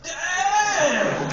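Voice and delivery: huge high, long mock shriek; hammed-up exclamation. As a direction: blurt it out inappropriately at full volume, falling at the end. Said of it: "And I'm like".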